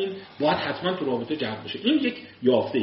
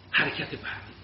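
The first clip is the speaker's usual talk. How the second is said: ordinary delivery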